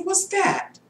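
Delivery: high, soft, shocked question; startled. Retold or imitated. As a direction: recoil at a strange feeling. Startled by her hunger.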